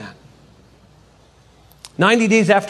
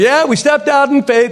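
high, animated testimony voice; mock testimony; 8 semitones higher, 4 dB louder than usual. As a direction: proclaim it as a rehearsed testimony. Imagined future testimony acted.

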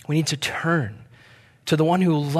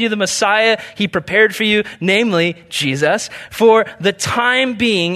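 raised, louder bible reading to the congregation; scripture reading; 6 semitones higher, 3 dB louder than usual. Acted. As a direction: read the passage out with preacher's lift. Reads scripture aloud.

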